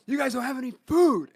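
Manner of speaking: high, loud mock indignation; imitated angry complaint. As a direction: act out a brother's exasperated complaint. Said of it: "he was so mad".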